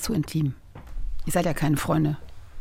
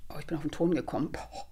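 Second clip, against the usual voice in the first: fast, whispered alarm; panicked whisper; 6 semitones lower, 13 dB quieter than usual. Real, not acted. Confessing she almost hit the sound button, a fast panicked whisper. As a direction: hiss out the slip in a rush.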